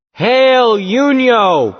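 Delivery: loud, high call across a field. Acted. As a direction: shout the name to be heard. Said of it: "I called out".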